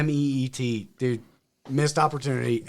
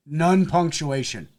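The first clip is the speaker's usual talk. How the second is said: ordinary delivery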